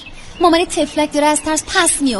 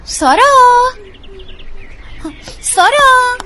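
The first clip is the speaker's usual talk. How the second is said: loud searching call, rising high; 7 semitones higher, 5 dB louder, slower than usual. Acted. Searcher calls out for the missing girl, loudly. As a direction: call the missing girl's name into the distance.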